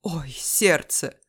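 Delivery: slow, loud theatrical groan; feigned heart attack. Acted. Cop clutches his heart for show, fake agony. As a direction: draw it out as mock pain.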